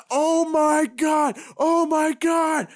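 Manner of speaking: high, drawn-out fan freakout; reenacted high-pitched amazement; freaking out. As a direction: stretch it out in a high, overwhelmed cry. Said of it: "two grown-ass men on FaceTime, just freaking".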